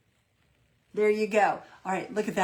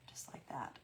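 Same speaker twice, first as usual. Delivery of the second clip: whisper; 6 semitones lower, 17 dB quieter than usual. Real, not acted. Whispered aside while painting.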